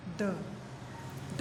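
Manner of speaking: "द" short and held inward, without stress; unstressed syllable. Shown as a demonstration. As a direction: keep "द" small and unaccented, not pushed out. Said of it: "द को हम अपने अंदर से बोल रहे हैं".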